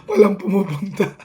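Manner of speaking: laughing loudly through the words; can't stop laughing while saying it. Real, not acted.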